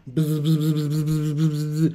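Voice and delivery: soft buzzing static noise; imitated static buzz. Viewer imitating the crackling radio connection, soft buzzing. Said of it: "la connexion grésille".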